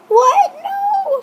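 sudden high startled protest, pitch jumping up; shocked cry. Acted. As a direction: gasp it out as if the news just hit. Character's shocked text reply.

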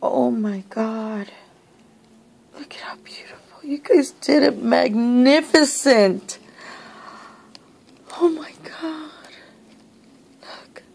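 hushed, breath-taken amazement; soft, nearly voiceless wonder; awestruck whisper. Real, not acted. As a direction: whisper in awe at a gift. Awestruck at opened gift.

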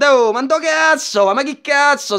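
ordinary delivery

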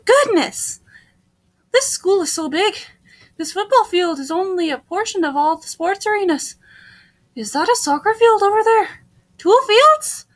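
animated wonder, lifting on questions; lightly voiced heroine. Newcomer amazed by the huge sports fields, animated. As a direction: marvel brightly at each new sight.

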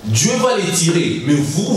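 usual speaking voice

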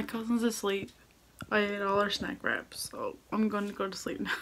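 hushed whisper, cousin asleep. Real, not acted. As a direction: whisper so she stays asleep.

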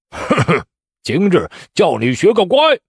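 gloating high-pitched taunt after a chuckle; cold sneer. A narrator's reading. Candidate gloating over the slapped examiner, sneering. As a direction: teach him a lesson with relish. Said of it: "江大清冷笑道".

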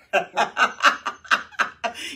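loud laugh; laughter. Real, not acted. Preacher laughing at his own Moses voice, loud laugh. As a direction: burst out laughing.

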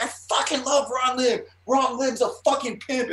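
very high-pitched ecstatic fan yell; gushing fan shout. Retold or imitated. Overexcited fan hugging his comics idol, gushing yell. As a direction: holler your adoration, acting it out. Grabs and hugs idol, yelling.

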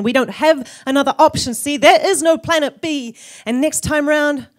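loud, high, insistent slam-poetry climax; urgent plea. Acted. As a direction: drive the warning home at full voice. Poem's urgent closing plea.

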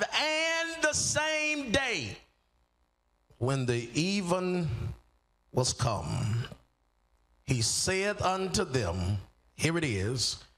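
slow, drawn-out scripture reading; solemn scripture reading. Acted. Preacher reading the verse to the congregation, slow and solemn. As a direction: read the verse with weight and space.

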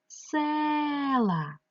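"села" slow, with a long drawn-out stressed "е"; stressed vowel. Shown as a demonstration. Teacher showing the vowel under stress, drawing it out. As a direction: stretch and hold the first vowel of "села". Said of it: "стало під наголосом".

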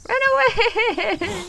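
high, drawn-out comic wail; panicked flight cry. Real, not acted. Player fleeing the creature they pissed off, high panicked wail. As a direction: stretch it out high while fleeing.

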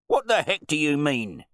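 loud, high-pitched outrage; blustering, affronted wine bore; indignant host. A narrator's reading. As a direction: challenge the butler in disbelief. Host challenged before guests.

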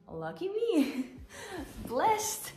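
high sarcastic lucky me; mock-cheerful, sarcastic. Real, not acted. Sarcastic about her bad luck.

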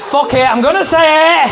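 high, loud mock-brave shout; bold declaration. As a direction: blurt it out with fake courage. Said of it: "You're like".

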